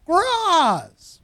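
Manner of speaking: high, loud, drawn-out shout; happy greeting call. Retold or imitated. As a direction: call out as if delighted to see someone. Greeting called out at mountain top.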